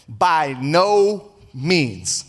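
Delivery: BY NO MEANS drawn out very slowly, each word weighted; emphatic refusal. Acted. Peter refuses the voice from heaven, slow and emphatic. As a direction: stretch out "By no means" as a firm refusal. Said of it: "That's an emphatic no".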